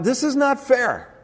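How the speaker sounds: slow, high whiny "not fair"; mock complaint. Pastor voices the bitter thought, slow and whiny. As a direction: drag out the sulky protest. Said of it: "the seed of bitterness is that thought".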